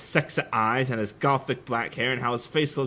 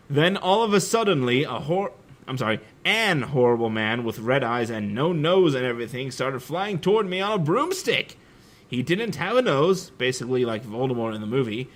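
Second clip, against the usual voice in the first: usual speaking voice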